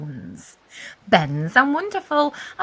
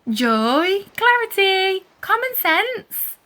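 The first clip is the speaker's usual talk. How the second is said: rising, louder calls, slowing on the last name; calling out; 8 semitones higher, 5 dB louder, slower than usual. A narrator's reading. Anna calling her busy older cousins, loud calls. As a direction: sing out each name to get their attention.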